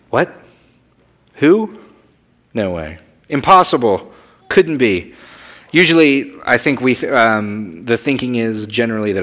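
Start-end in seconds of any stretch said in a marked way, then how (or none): 0.1-5.1 mock disbelief. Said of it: "That's like".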